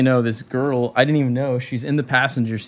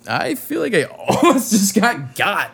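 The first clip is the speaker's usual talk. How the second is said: higher, laughing through the words; laughing relief; 7 semitones higher, 4 dB louder than usual. Real, not acted. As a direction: laugh it off, still shaken. Laughing off the scare.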